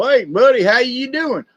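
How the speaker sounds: a mild Southern buddy hello; Southern drawl. Shown as a demonstration. Showing how folks in the South talk, mild Southern drawl. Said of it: "where they talk like this a lot".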